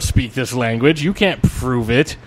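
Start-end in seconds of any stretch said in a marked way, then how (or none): none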